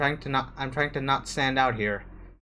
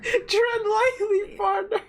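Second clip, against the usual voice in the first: much higher, very slow teasing drawl; mock cowboy drawl; much slower than usual. Friend mocking the quoted line back, slow high mock drawl. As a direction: stretch it out to tease your friend. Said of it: "Astra said".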